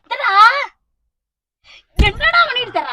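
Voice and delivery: high, loud exasperated question; angry demand. Acted. Mother confronting son over what he did, angry. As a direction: demand an explanation hotly.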